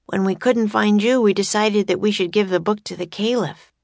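lightly voiced boy Jack. A narrator's reading. A reader voices Jack explaining to Mamoon, lightly boyish.

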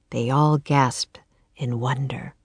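whispery, low narration; hushed wonder. A narrator's reading. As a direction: tell it in an awed hush. Narrator hushes at the castle sight.

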